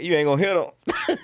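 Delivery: high, firm mock warning. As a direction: tell him off, half joking. Stepping into a bar fight.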